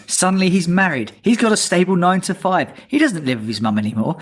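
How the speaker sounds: ordinary delivery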